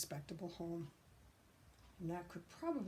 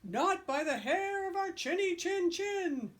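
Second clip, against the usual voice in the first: slow, high, loud defiant pig; two pigs refusing the wolf entry; high pig refusal; 11 semitones higher, 6 dB louder than usual. Acted. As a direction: shout back defiantly.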